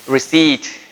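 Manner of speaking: Receipt said as ree-SEET, p silent; Receipt modelled cleanly, no p; Receipt with silent p, teacher model; model pronunciation. Shown as a demonstration. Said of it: "how do you say that?".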